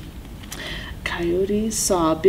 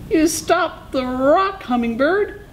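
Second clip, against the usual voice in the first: amazed Coyote; 11 semitones higher than usual. A narrator's reading. Coyote amazed at being rescued.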